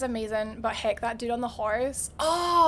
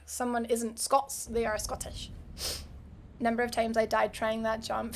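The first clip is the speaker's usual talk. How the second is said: ordinary talk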